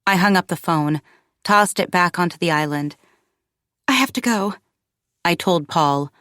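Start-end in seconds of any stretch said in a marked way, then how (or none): 3.9-4.5 mildly frantic, raised pitch; a touch of panic, pitched up; flutter of panic. A narrator's reading. Mother telling Paul she must leave, mildly frantic. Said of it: "a flutter of panic, franticness in my tone".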